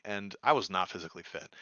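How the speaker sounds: normal talking voice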